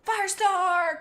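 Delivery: high, loud cry of her name. Acted. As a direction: Moonflower cries out as she runs to Firestar. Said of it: "cried Moonflower".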